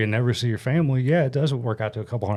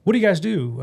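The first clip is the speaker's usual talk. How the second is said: higher, brighter boss asking round the table; upbeat CEO voice; 7 semitones higher, 3 dB louder than usual. Retold or imitated. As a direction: play the chirpy CEO going round the table. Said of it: "starts asking us".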